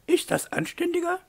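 very high lady's questioning protest; protesting mademoiselle. A narrator's reading. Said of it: "protestierte Aglaé".

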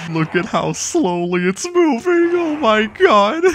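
very high, slow squeal of disbelief; incredulous amazement. Real, not acted. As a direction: drag it out, shocked and amused. Game lagging absurdly slowly.

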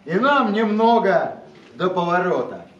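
declaiming a poem to a live audience; dramatic poetry declamation. Acted. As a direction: recite the verse with weight and rhythm. Poet reads own poem aloud.

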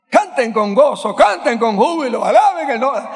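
loud, high call to sing; rally the church to praise; preaching at full force. Acted. Preacher urging the congregation to sing, loud and high. Preacher proclaims verse loudly.

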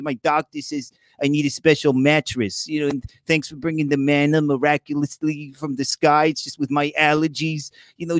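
lightly voiced whiny complainer. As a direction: follower complaining to Moses about comfort, lightly whiny. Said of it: "enough of the personality bits already".

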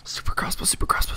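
whispered to himself, barely voiced. Real, not acted. Gamer mulling a weapon pick, whispering to himself. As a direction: breathe the options under your breath.

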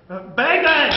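loud, high pretend gun noise; mock gunshots. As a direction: shout bang bang like a kid playing. Fake gunshot in sketch.

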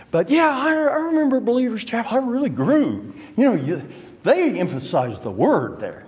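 high-pitched, chatty reminiscing as a former member; former chapel member. Acted. Former member recalling Believer's Chapel to an acquaintance, high-pitched reminiscing.

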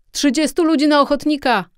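loud, high-pitched call to the troops. A narrator's reading. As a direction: shout for volunteers like an officer. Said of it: "zawołał, zwracając się do swych żołnierzy".

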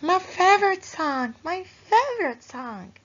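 put-on high, bright child's voice, delighted; little girl Sarah. A narrator's reading. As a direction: sing it out like a happy little girl. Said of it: "says Sarah".

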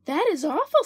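high dismayed Amelia. A narrator's reading.

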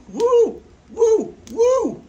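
very high, loud cheering woo; excited whoops. Real, not acted. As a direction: hype the fish up with shrill cheers. Hyping up the fish.

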